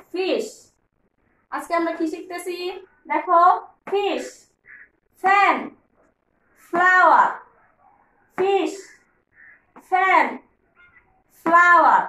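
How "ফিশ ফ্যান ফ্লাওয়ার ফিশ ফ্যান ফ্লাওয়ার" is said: loud call-and-response word drill; drill for repeating. Shown as a demonstration. Teacher drilling kids to repeat, loud chant. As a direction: chant the words for kids to echo. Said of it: "আমার সাথে সাথে বলো".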